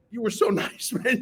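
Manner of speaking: usual speaking voice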